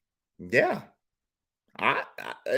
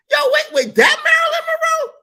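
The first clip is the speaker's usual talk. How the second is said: shocked shout. Retold or imitated. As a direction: blurt it out in total disbelief. Disbelief shouted.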